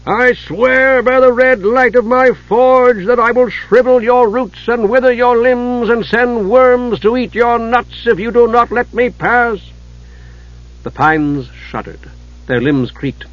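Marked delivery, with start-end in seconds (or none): 0.1-9.6 high, loud, slow furious dwarf; booming dwarf cry. A narrator's reading. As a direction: bellow it like a blast of wind. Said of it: "cried in a voice that was like a blast of wind".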